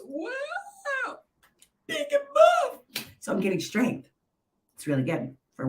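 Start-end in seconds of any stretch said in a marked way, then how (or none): 0.0-3.1 high, slow mock boast; hammed-up showing off muscles; mock impressed. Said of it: "Look at this".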